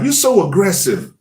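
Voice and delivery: high-pitched mock reproach; lightly put-on wife voice; imitating wife. Mimics wife's reproach in story.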